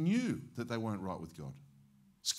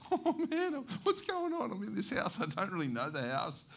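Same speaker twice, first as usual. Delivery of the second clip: frightened squeak; 9 semitones higher than usual. Retold or imitated. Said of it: "I'm starting to".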